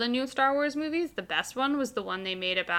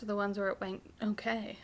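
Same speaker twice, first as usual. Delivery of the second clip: whispering; 9 dB quieter than usual. Real, not acted. Drops to a whisper.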